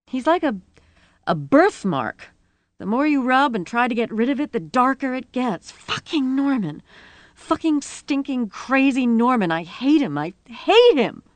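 high-pitched, mounting rant; tearful rage. A narrator's reading. Rosie venting her hatred of Norman, furious. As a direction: rant on, building to a loud last burst. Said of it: "she was weeping with rage".

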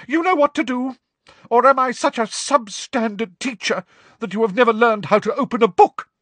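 high, loud wounded Trelawney; a seer on the verge of tears, lashing out; hysterical. A narrator's reading. Trelawney to the class, high and hysterical. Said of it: "high-pitched and somewhat hysterical".

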